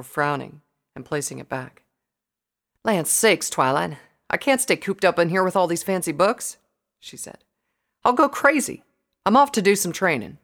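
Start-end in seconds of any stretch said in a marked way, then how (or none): 2.8-6.6 loud, raised country pony voice; fed-up, folksy character voice; Applejack, exasperated. A narrator's reading. Narrator voices restless Applejack. 8.0-10.4 loud, raised country pony voice; fed-up, folksy character voice; Applejack, exasperated. A narrator's reading. Applejack's complaint continues.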